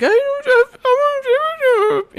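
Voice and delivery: very high, slow whimpering voice; crying child voice. Acted. GM voicing a hidden crying child, high and tearful. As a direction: sob it out high and small.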